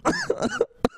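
high squeal of laughter; real shriek of laughter. Real, not acted. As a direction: burst out laughing. Laughing fit.

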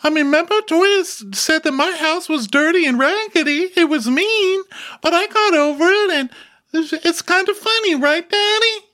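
very high, loud Beaker-style squeak; Muppet-like squeaky voice. Retold or imitated. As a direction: pour out the story in a tiny squeaky voice. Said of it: "in that voice".

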